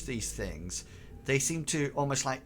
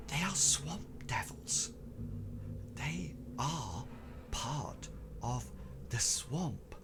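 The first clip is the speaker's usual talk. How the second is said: hushed whisper, slowly fading quieter; Tobias; 7 dB quieter, slower than usual. Acted. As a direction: speak secretively, in halting, whispered fragments. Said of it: "his voice is actually getting quieter and quieter".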